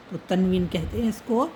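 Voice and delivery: normal talking voice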